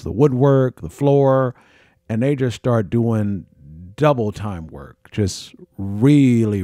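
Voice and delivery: ordinary talk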